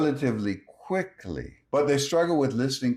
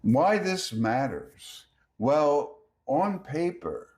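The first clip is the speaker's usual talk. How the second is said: ordinary talk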